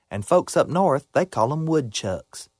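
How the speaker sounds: ordinary delivery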